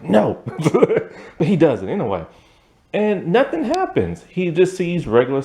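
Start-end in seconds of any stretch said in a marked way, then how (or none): 0.0-1.0 laughing refusal. Retold or imitated. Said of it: "He's like".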